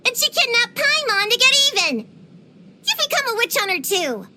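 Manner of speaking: high-pitched accusation; indignant. Acted. Imagining Paimon kidnapped in revenge, indignant. Building the case against rival.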